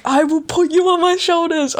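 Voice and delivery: the friend's excited offer; eager friend. Retold or imitated.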